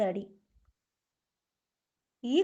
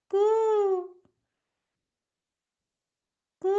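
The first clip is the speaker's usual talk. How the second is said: jackal howl; 6 semitones higher than usual. Acted. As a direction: howl like a jackal, one long note. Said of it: "കുറുക്കന്റെ ശബ്ദം". (കുറുക്കന്റെ നീട്ടിയുള്ള കൂവൽ)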